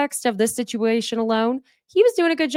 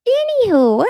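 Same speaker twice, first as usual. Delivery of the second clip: sing-song; 8 dB louder than usual. Real, not acted. Bouncing back to the topic.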